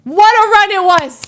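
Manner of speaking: high-pitched, delighted exclamation; exuberant. Retold or imitated. Fellow gambler reminiscing at the bar, exuberant. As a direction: celebrate it like a fellow winner. Acted stranger celebrating shared run.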